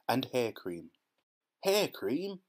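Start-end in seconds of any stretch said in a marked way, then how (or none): 1.6-2.4 much higher, rising in disbelief; can't believe what he read; surprised cry. A narrator's reading. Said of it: "cried Mike Teavee".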